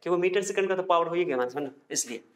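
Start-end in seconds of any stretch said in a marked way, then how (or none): none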